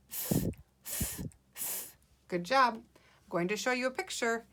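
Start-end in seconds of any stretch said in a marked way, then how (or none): 0.1-1.9 "Th" as voiceless air through the teeth; "th" alone, three soft puffs without a vowel; digraph sound. Shown as a demonstration. Teacher drills digraphs with pupils, isolated "th" sounds. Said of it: "Let's make these sounds three times each".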